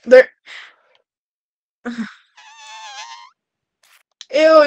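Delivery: ordinary delivery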